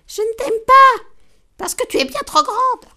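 very high, loud, squeaky rage; the tiny ant blows her top; furious. Acted. The enraged ant snaps at the giraffe, high and shrieking. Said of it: "Le sang de la fourmi ne fit qu'un tour".

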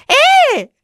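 put-on little girl calling out to her grieving parents; gleeful shout. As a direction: burst in announcing herself. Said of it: "cria Charlotte".

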